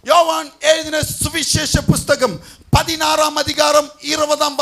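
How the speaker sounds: loud, ringing scripture announcement; preaching at full force. Real, not acted. Preacher announcing the month's promise verse, full-force proclamation. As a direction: proclaim it emphatically to a whole hall.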